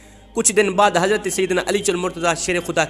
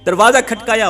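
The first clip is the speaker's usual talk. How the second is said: loud emphatic repeat; 3 semitones higher, 7 dB louder than usual. Shown as a demonstration. Preacher repeating the knock to the congregation, loud. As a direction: punch it out like the knock.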